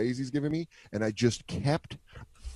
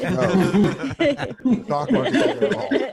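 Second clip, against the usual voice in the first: laughing realization; 9 semitones higher, 6 dB louder, much slower than usual. Retold or imitated. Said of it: "And they're like".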